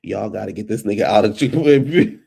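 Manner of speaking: normal talking voice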